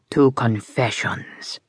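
a slow, lightly voiced mobster toast; slow toasting gangster. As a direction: raise a glass with lazy menace. Micah's toast.

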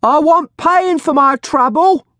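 high, loud, slow crone shout; bold shrill shout. A narrator's reading. Old woman demanding coins from the crowd, bold shout. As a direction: shout boldly like an old beldam. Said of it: "the beldam shouted boldly".

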